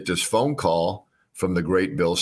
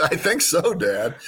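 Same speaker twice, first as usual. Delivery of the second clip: excited; 9 semitones higher, slower than usual. Retold or imitated. Answers father, giddy.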